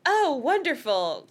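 a touch of a drawn-out groan; sarcastic dismay, dragged slowly; mild moan. A narrator's reading. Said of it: "Cassie moaned".

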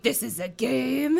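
lightly rasped threat; a touch of rasp on the question; menacing masked man, understated; mild rasp. A narrator's reading.

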